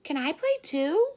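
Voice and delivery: high, eager asking to join; hopeful caterpillar. Acted. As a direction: plead sweetly to be let in. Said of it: "said Charlie".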